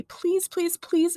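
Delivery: high, repeated begging; pleading cry. A narrator's reading. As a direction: beg like a desperate kid. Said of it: "cried Toby".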